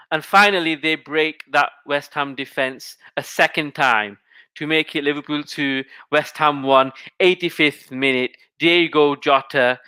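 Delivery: ordinary delivery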